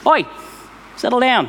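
a light owner's "oi" to calm the dog; scolding a dog. Retold or imitated. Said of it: "someone dealing with their dog going nuts".

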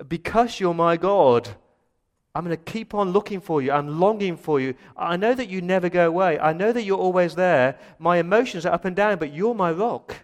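loud, high, insistent prayer; David's impassioned cry. Acted. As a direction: pour out devotion at full force. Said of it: "He's almost saying".

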